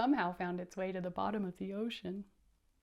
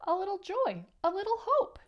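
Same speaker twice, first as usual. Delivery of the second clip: squid voice; 10 semitones higher, 5 dB louder, faster than usual. Acted. Squid's mocking high lines.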